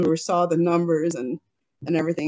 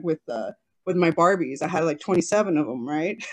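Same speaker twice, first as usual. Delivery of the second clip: ordinary talk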